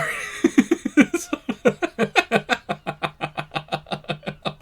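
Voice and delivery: high, breathless giggling fit; can't stop laughing; real helpless laughter. Real, not acted. Player cracks up at game.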